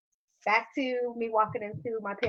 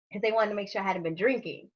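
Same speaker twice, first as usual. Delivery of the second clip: ordinary talk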